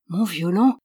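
mildly surprised exclamation; a small, understated start of surprise. A narrator's reading. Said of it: "dit-il d'un ton surpris". (légère surprise)

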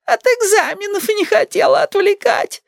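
high granny whine; querulous grandma. A narrator's reading. Grandmother excuses herself.

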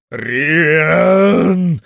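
yell. A narrator's reading. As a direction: bellow it from the top shelf. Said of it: "орёт с полка".

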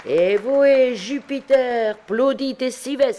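high, loud, drawn-out cheer; schoolboys' shout. A narrator's reading. Students applauding Jupiter's announcement, shouting. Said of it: "crièrent les écoliers".

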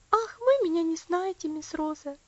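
put-on high child's voice, loud at first; protesting little girl. Elsie telling Rosa she doesn't know her, protesting. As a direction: object earnestly as a little girl.

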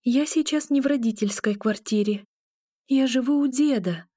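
mildly anxious, talking herself down; self-reassuring. A narrator's reading. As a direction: try to convince yourself you're safe. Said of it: "заверяла себя".

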